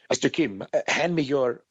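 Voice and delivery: put-on light French Canadian accent, a pregnant pause before phaser; mock French Canadian actress, hesitating on the hard word; French Canadian accent with a halting pause before the last word; imitating a French Canadian accent. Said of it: "in her French Canadian accent".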